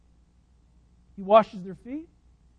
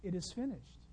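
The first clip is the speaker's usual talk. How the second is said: gentle Jesus; 10 dB quieter than usual. Retold or imitated. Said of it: "Jesus says".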